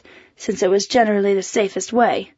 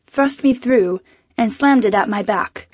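plain reading voice

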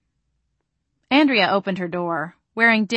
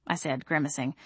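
usual speaking voice

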